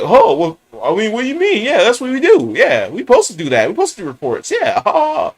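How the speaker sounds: a lightly voiced snippy boss, brushing it off; imitating the regional manager's mouthy sarcasm; mock sarcastic manager. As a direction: mimic her sarcastic back-talk. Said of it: "hella always trying to be, like, sarcastic".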